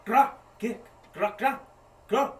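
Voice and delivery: high, loud guttural grunts; goblin gibberish. Acted. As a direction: bark out creature gibberish. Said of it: "she goes".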